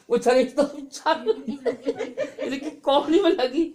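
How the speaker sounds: helpless laughter breaking into the words; slow, high, laugh-choked telling. Real, not acted. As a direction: tell it through real laughter, pitch jumping up. Laughs telling of the bus bump.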